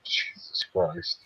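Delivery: breathy whisper. Real, not acted. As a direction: whisper it in dismay. Whispered reaction.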